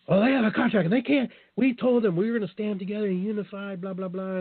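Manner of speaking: high mocking voice of the official. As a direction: mimic him, high and sneering. Mocking the contract guy.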